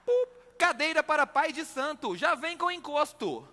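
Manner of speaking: high, slowed sponsor announcer; mock ad read. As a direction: read it like a radio ad.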